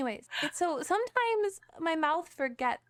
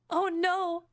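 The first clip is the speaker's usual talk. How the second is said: ordinary talk, nothing marked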